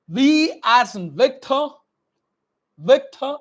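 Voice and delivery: Victor with a crisp V; Victor, not vector; clear V in Victor. Shown as a demonstration. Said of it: "it's not a vector".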